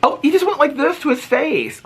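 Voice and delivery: high, delighted exclaim. Real, not acted. Delighted by chipmunk.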